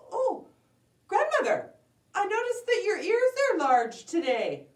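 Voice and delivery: put-on high, loud girlish wonder; astonished little girl. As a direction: exclaim in a high child's voice. Girl noticing large ears.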